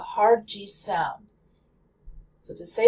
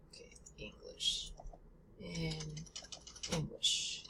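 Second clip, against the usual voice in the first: whisper; 3 semitones lower, 19 dB quieter than usual. Real, not acted. Whispered aside to himself.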